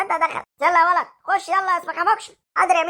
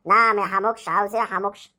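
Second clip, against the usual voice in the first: lower, stern schoolteacher voice; impatient teacher, lower; 8 semitones lower than usual. Acted. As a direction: scold the pupil from above.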